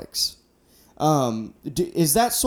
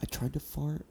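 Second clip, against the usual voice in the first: much softer, fast muttered admission; hushed confession; 10 dB quieter, faster than usual. Retold or imitated. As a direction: confess it under your breath. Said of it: "He's like".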